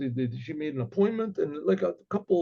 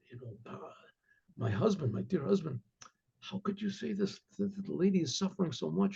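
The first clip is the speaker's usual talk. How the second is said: soft, much quieter private rebuke; hushed whisper; 2 semitones higher, 8 dB quieter than usual. Retold or imitated. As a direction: whisper it so the guest can't hear. Wife quietly confronts husband privately.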